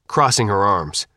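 ordinary delivery, nothing marked

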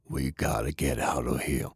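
low, soft and groggy; a hurt man's low murmur; wounded heavy. Acted. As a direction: Sullivan just coming to, low and soft. Sullivan wakes up wounded.

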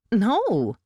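a quick high exclaimed no; sharp startled cry of refusal; cried out. A narrator's reading. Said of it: "she cried".

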